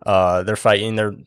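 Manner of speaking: ordinary talk, nothing marked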